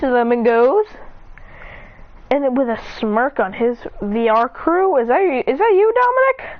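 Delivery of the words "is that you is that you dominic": high, rising call to a friend; questioning call. Real, not acted. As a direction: call out to check who is there, pitch climbing.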